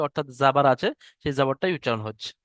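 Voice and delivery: normal talking voice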